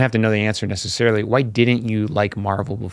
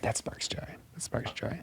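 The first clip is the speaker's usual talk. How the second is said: hushed, voiceless whisper; whispered echo; 20 dB quieter than usual. Pretend. Co-host echoing the catchphrase back to the host, whispered. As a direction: whisper it like a secret mantra.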